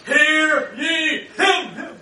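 slow, booming divine proclamation; thundering voice. Acted. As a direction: boom it down from the clouds, slowly. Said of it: "this thundering voice".

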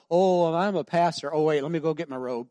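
a touch of a preachy pastor voice; mock pastor. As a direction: play the showy pastor for a laugh. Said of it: "I don't go".

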